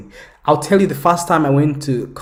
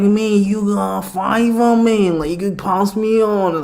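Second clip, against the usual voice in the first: put-on high, drawn-out drunk Scottish plea; slurry Scottish accent impression, pitched up; drunk Scot; 7 semitones higher, slower than usual. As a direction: imitate a drunk Glaswegian with a strong Scottish accent.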